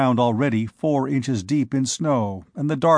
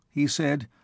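usual speaking voice

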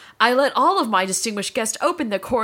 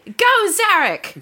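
mock cheer; 9 semitones higher, 6 dB louder, slower than usual. Cheering on the potty.

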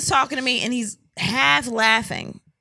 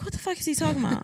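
higher, softer, quick muttered question; retold baffled aside; 6 semitones higher, 7 dB quieter, much faster than usual. Wife to herself about partner's call, baffled aside. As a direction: toss it off under your breath, puzzled.

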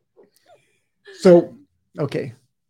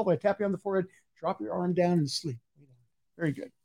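softer, slowing count-out; hypnotic induction; 5 dB quieter than usual. Acted. Hypnotist counting the volunteer awake, softly. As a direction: ease her back gently.

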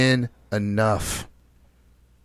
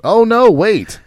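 louder, higher "oh wait, only a couple"; higher, louder jokey backtrack; mock self-correction; 6 semitones higher, 5 dB louder than usual. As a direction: catch yourself in a joking panic.

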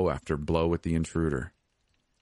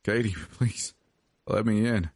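higher, broken plea; tearful, pitched-up pleading; nearly sobbing; 4 semitones higher than usual. A narrator's reading. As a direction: beg as if close to tears. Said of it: "he was nearly sobbing now".

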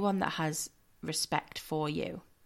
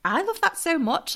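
excited; 10 semitones higher, 5 dB louder than usual. Real, not acted. Gushing agreement about the photoshoot.

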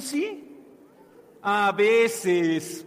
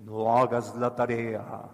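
much lower, sly devil voice; 12 semitones lower than usual. Acted. Devil whispering to children, deep and sly. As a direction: tempt like a devil, low. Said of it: "cuando nos dicen".

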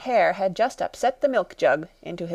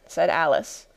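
ordinary delivery

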